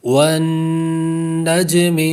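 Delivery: slow drawn-out tajweed recitation of والنجم with nasal ghunna on the doubled nun; تجوید کے ساتھ والنجم; Quranic recitation. Shown as a demonstration. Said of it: "نون مشدد کا غنہ".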